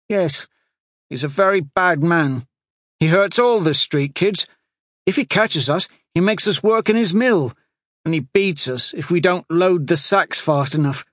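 put-on a small abused boy telling his story; frightened street boy. A narrator's reading. Street boy telling the sorcerer about his master, high child voice.

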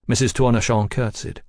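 usual speaking voice

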